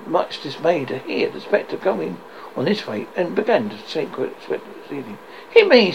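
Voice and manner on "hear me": higher, louder urgent plea; urgent command. Acted. Ghost with little time left, urgent loud command. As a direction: demand his attention. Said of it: "said the ghost".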